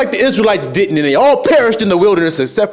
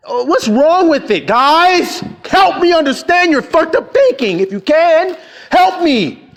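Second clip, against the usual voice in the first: exasperated plea; 6 semitones higher than usual. Real, not acted.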